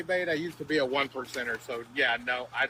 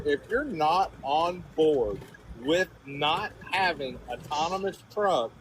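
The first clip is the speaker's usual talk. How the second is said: slow, deliberate, word by word; slower restatement; slower than usual. Real, not acted. Trucker rephrasing a garbled point for the panel, slowly. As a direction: spell it out slowly and firmly. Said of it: "let me say it slower".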